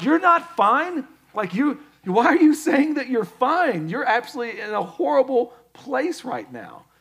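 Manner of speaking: high, loud disbelieving protest; mock incredulous challenge. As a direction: call out the fib in exaggerated disbelief. Acted challenge to a false "fine.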